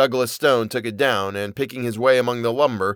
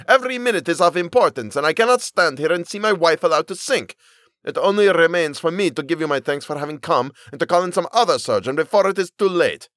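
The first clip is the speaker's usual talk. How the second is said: high, loud and fast angry husband; 7 semitones higher, 3 dB louder than usual. A narrator's reading. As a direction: dismiss the surgeon in a fury. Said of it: "the Turk cried angrily".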